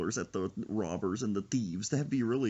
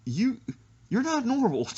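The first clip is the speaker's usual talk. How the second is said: retold exclamation; 13 semitones higher, 5 dB louder than usual. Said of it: "I was like".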